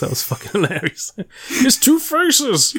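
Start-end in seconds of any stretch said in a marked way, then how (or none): 1.5-2.8 high, slow stretched-out cartoon line; goofy high cartoon voice, very slow; mock cartoon voice. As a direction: drag out two faces in a silly high voice. Mocking the villain's name.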